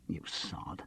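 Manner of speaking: under the breath, voiceless and very soft; a fond curse, whispered; whispered insult. Acted. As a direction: breathe the insult so he barely hears. Muttered insult to friend.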